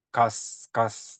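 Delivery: "가스" left open on a long s; open, hissed ending. Shown as a demonstration. Teacher showing it is no entering tone, "가스" with sustained s. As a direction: let the s of "가스" hiss on instead of closing. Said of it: "입성은 딱 닫아야 되거든요".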